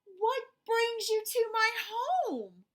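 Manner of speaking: high, loud, slow call; big slow excited shout; shouted greeting. Acted. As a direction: call out to the boy in surprise. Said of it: "Amu shouted".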